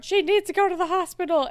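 high, quick urgent line; lightly acted urgent insistence; retold alarm. Viewer recalling her note about the fainted girl, mildly alarmed.

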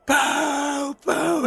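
high, drawn-out boos; crowd booing. Acted. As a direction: jeer like a stadium crowd. Crowd boos as vegetables fly.

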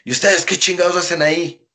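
sharp, high, loud scolding; angry yell. Retold or imitated. Negro Casas yelling at the young wrestlers, angry. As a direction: snap at the boys like an angry boss.

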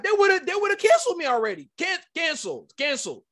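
high-pitched, worked-up protest; indignant exclamation. Real, not acted. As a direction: cry out the injustice in a high voice. Indignant about a double standard.

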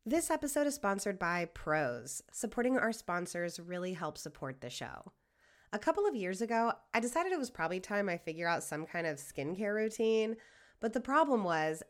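tidy scripted endorsement; sponsor ad read. Acted. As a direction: read the sponsor copy smoothly and quietly. Reads a sponsor ad.

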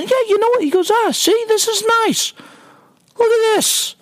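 high, light mock dad voice, pleased; a lightly hammed-up happy father; imitating dad. Dad voiced admiring the cemetery.

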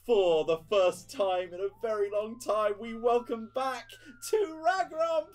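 rising to a huge, high shout; announcer. Acted. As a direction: build the introduction to a roar. Mayor announcing the hero.

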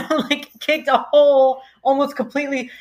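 ordinary talk

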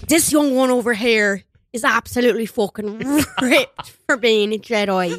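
broad mock Irish accent; hammed-up Irish Jedi, laughing partway; Liam Neeson. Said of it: "He's just talking like Liam Neeson".

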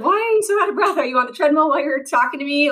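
high, worried doctor voice; mock-alarmed doctor, quickening. Rheumatologist on a video call questioning her breathing, alarmed and high. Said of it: "And she's like".

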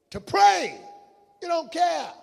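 high, loud pulpit shout; forceful shouted reproach; shouted preaching rebuke. Real, not acted. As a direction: hurl it at the congregation.

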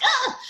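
a sudden high squeal; high yelp. Retold or imitated. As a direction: let out a startled high yelp. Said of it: "when I say something like".

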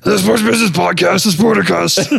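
mumbled Cajun coach voice, marbles in mouth; Ed Orgeron voice. Shown as a demonstration. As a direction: talk like marbles in your mouth. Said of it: "Ed Orgeron voice".

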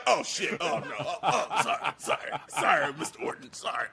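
low, hushed, stammered apologies; mock terrified. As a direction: imitate a scared man backing down, fading away. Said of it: "He was terrified".

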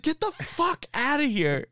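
high put-on voice telling him to get lost; mock rude retort. Ponytail guy hammed up, shooing the neighbour away, high mock retort. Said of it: "I was like".

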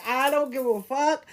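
ordinary talk

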